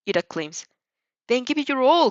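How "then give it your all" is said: louder, higher, rallying call; a hero's loud rallying cheer; hearty booming cheer. Acted. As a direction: fire up the student with a big booming voice. All Might cheering on student.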